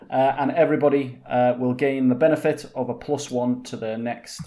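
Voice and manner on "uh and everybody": ordinary talk, nothing marked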